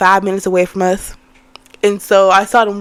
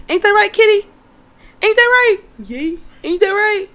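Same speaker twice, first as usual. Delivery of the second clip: pet talk; 9 semitones higher than usual. Real, not acted. Mom turns to her cat and asks in high cooing baby talk. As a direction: coo at the kitty.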